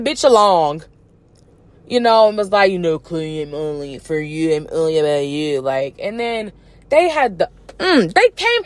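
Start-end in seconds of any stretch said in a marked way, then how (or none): 3.0-5.6 low, soft smooth-talking lover; hushed mock romancing in a deep register; mock sweet-talker. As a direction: drop low and croon false devotion. Acts out Tristan sweet-talking a woman.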